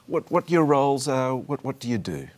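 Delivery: normal talking voice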